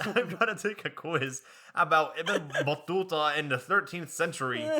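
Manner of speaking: loud laughing mock rant.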